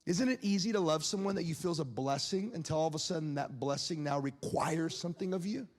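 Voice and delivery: ordinary delivery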